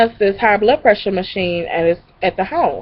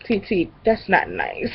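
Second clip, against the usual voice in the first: soft scolding nephew; 8 dB quieter than usual. Retold or imitated. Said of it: "My nephew said".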